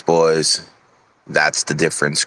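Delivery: usual speaking voice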